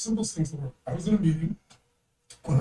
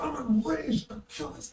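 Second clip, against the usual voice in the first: high-pitched imitation of a raging partner; mock rage; 9 semitones higher than usual. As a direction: act out his rage without shouting. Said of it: "One of my partners goes apeshit".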